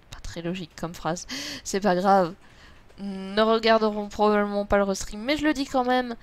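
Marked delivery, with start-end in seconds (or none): none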